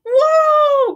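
long, high, loud whoa; acted alarmed groan. A narrator's reading. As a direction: cry out as the branch gives way. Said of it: "groaned Tortoise and Bird".

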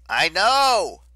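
high, loud shout; urgent retort. A narrator's reading. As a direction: shout to cut off the warning. Leo cuts off urgent warning.

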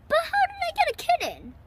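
high, quick puzzled question; baffled. A narrator's reading. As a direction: ask in bewildered surprise. Katie puzzled by unexpected kitten.

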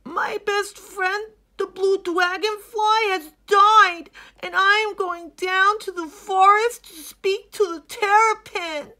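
high, loud, tearful hippo voice, fading to a whisper; sad young hippo. Acted. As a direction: pour out grief, then whisper the end. Hilmi explains his journey.

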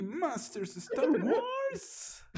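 high, very slow, drawn-out imitation; mock the youtuber's overdone delivery; imitating a youtuber. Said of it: "Y cómo habla así".

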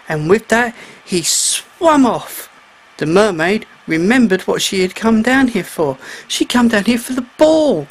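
usual speaking voice

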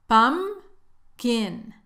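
"Pumpkin" slowly split as pum, kin; drawn-out teaching "Pumpkin", one syllable at a time. Shown as a demonstration. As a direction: pause between the two halves of "Pumpkin". Teacher splits the word in two.

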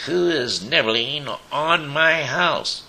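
high, loud, drawn-out witch's challenge; sharp, high-pitched demand to trespassers. A narrator's reading. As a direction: screech it slowly like a storybook witch. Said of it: "A sharp voice".

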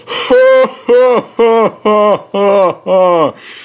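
high, loud acted chortle; long run of hammed-up engine laughter. A narrator's reading. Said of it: "He chortled".

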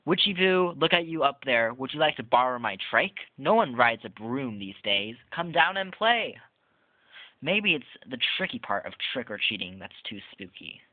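lively, sing-song storybook read-aloud; reading to children. Acted. Reviewer reading the book Boo aloud to viewers, playful storybook voice. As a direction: read it like a Halloween picture book to little kids.

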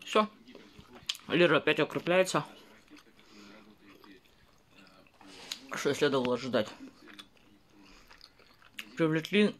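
speaking between bites; talking while chewing. Real, not acted. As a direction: mouth partly full of food. Seen 1: bites crepe before, cheeks bulge and lips work while talking.

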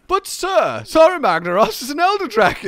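high, loud mock protest; protesting subordinate. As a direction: plead upward to a commander. Plays subordinate answering commander.

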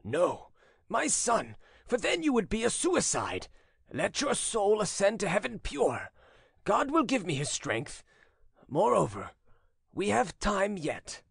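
put-on solemn, high-pitched old father. A narrator's reading. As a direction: exhort your son with grave fervour. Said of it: "returned Solomon solemnly".

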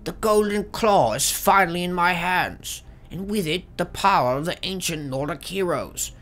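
high strained voice for Arvel; Arvel journal voice. Pretend. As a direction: read the journal as a nervous thief. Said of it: "what do they sound like?".

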